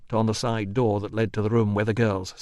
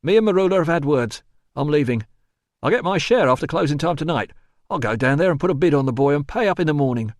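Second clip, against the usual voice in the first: brisk, raised rough-and-ready voice; Stickus; 5 semitones higher, 4 dB louder, faster than usual. Acted. Helper promising to bid on the boy, decisive. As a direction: lay out the plan decisively.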